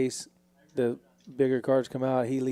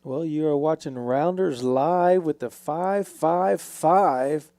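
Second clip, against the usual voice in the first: announcer voice; 3 semitones higher, 6 dB louder, slower than usual. Acted. Show intro announcement.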